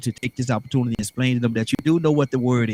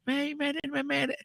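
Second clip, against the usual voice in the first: mocking listener; 11 dB quieter, slower than usual. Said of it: "they thinking that you don't know".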